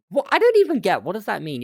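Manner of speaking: loud, high-pitched bafflement; baffled outburst, jumping up high and loud; really confused. Real, not acted. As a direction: burst out in exasperated confusion. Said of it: "I'm really confused".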